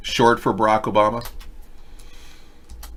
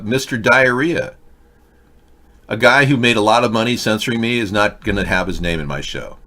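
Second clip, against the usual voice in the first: ordinary delivery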